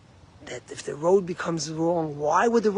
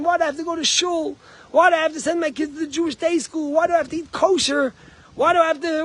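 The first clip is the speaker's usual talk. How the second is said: high, loud reluctant whining; kvetching about every duty; whiny complaining; 12 semitones higher, 6 dB louder than usual. Retold or imitated. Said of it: "When you carry it as a burden".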